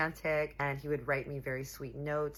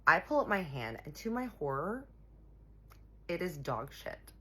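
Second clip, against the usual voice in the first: ordinary talk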